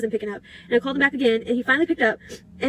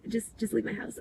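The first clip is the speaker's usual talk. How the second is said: very soft retold dismissal; quietly dismissive; 11 dB quieter than usual. Telling him to leave the party, quietly dismissive.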